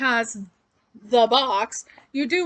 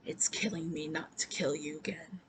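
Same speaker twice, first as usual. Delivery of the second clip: hushed, breathy menacing whisper, much softer; imitating a whisper; 5 semitones lower, 11 dB quieter than usual. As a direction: lean in and breathe it into his ear. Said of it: "Just whispers it".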